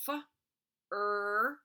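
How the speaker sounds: F, then loud er; sounding out. Shown as a demonstration. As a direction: stretch out F and a loud er. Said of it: "I can't hear that I very well".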